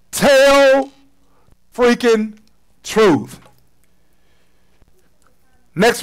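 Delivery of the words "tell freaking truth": "tell", "freaking", "truth" each set apart by pauses; word-by-word emphasis. Shown as a demonstration. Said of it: "So again".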